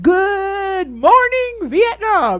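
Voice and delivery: drawn-out radio greeting, slow; lightly imitated Robin Williams. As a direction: stretch the morning out like a DJ. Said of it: "you know how Robin Williams said".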